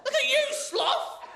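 high, slow mock goose shout; goose yelling. Goose yells at sloth to shame it, high and slow. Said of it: "the goose yelled up at the sloth".

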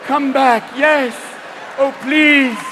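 high, loud eerie chant; mock Borg. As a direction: lure them back to the collective. Borg calling Bluetooth wearer.

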